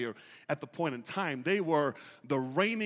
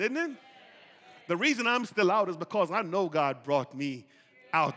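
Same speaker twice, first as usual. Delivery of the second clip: ordinary talk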